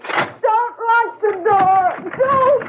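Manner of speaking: high, slow calls through the door; locked-out man pleading through the door; desperate pleading. Acted. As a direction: beg her not to lock it, drawn out.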